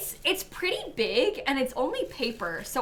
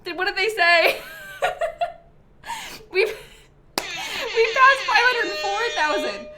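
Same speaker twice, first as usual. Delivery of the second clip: real teary laugh, higher and louder; choked-up giggle breaking the words; laughing through tears; 7 semitones higher, 5 dB louder than usual. Real, not acted. Streamer reading chat through tears as the total climbs. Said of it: "No, not through my tears".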